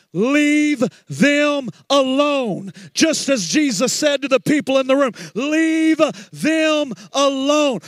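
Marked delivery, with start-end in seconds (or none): 0.1-2.7 slow, stretched-out plea, every word held; drawn-out rebuke. Acted. Preacher answers the complaining church member with a slow drawn-out rebuke. 5.4-7.8 slow, loud drawn-out cry; preached shout. Acted. Pastor voices Jesus to the room in a slow preaching shout.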